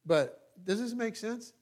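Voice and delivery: usual speaking voice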